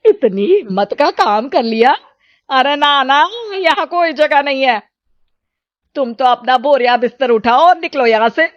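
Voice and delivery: high, dismissive refusal; harsh mother. Acted. Mother refusing her daughter shelter, high and dismissive. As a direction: shoo her away.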